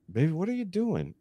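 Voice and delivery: high, softened put-on female voice; imitated wife. Wife woken by the bump questions her husband, in a high soft voice. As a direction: lift your pitch high to mimic a woken wife.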